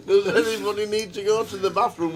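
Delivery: re-quote his own line, cracking up; laughing repeat. Real, not acted. Host repeats his bathroom question to the table, laughing loudly.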